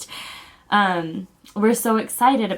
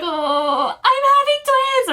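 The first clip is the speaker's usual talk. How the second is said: very high squealed announcement; retold giddy high-pitched blurt; hysterical blurting; 4 dB louder, slower than usual. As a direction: wail the big news, overcome. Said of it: "crying because I was laughing so".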